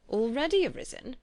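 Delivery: a lightly voiced amused father; mock-surprised father. A narrator's reading. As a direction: tease her with the echo. Father echoes her words.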